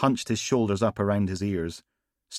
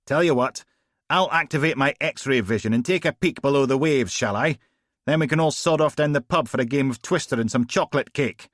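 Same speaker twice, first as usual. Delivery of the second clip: louder, higher heavy sarcasm; mock him for the stupid question; sarcastic Steele; 6 semitones higher, 5 dB louder than usual. Steele sarcastic to Logan about X-ray vision, louder.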